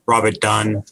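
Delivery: ordinary delivery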